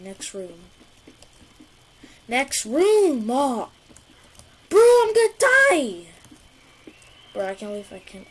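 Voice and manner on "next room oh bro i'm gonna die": panicked shout, high and loud; panicking gamer. Real, not acted. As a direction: yell in sheer panic. Chased by monster in game.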